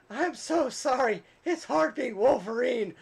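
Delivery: high, slow fake-sorry whine; mock whiny apology.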